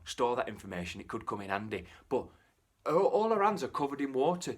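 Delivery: ordinary delivery, nothing marked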